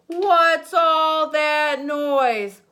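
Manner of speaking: slow, loud, high grumpy bear roar; cranky bear shouting slowly at the noise; grumpy bear bellow. Acted.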